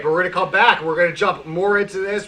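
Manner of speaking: ordinary talk, nothing marked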